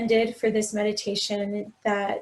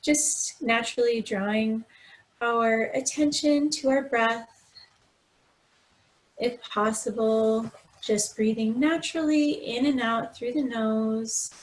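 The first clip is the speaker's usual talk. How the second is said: guided meditation; 4 dB quieter than usual. Acted. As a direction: gently guide them through breath and body, unhurried. Leads a body scan meditation.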